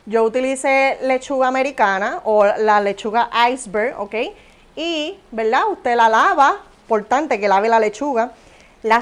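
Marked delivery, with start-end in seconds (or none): none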